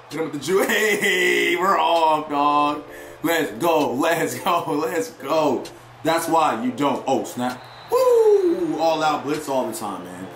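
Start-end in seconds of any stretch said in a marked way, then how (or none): none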